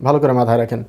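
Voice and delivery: usual speaking voice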